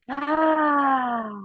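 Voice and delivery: long audible open-mouthed sigh out; demo sigh. Shown as a demonstration. Coach showing the class how to sigh out, a long open sigh. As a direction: let the breath fall out on an ah. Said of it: "Sigh out your mouth".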